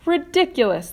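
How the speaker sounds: high, loud scornful shout. A narrator's reading. The bugs shout down James's plan, loud and high. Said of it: "they shouted".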